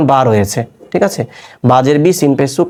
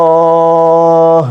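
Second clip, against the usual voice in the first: Quran recitation; much slower than usual. Shown as a demonstration. Teacher demonstrating a pause with long madd, chanted slowly. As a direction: draw out মিনাল্লাহ as in tilawat. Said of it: "তাহলে কি হচ্ছে".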